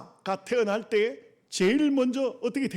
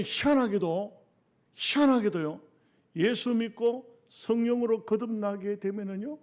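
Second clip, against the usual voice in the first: ordinary talk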